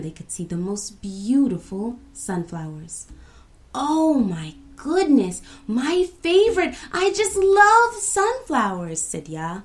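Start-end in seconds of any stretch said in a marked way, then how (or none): 3.7-9.0 high, loud, drawn-out gush; gushing delight. A narrator's reading. Ya seeing her favorite flowers, gushing delight. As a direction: squeal with love for them.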